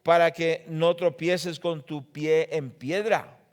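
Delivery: loud, slow preaching of the text; booming sermon reading; fervent scripture reading. Acted. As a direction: proclaim the verses with full force. Preacher reads the temptation scripture.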